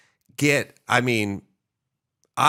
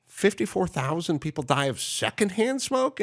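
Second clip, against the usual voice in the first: incredulous question; 6 semitones higher than usual. Retold or imitated. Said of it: "I would say to Doctor Drew".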